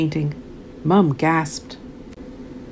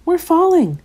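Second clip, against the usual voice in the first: high, panicky splutter; 10 semitones higher, 3 dB louder than usual. A narrator's reading. Owl crying out as they tumble, alarmed. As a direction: blurt it out mid-fall. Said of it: "spluttered Ollie".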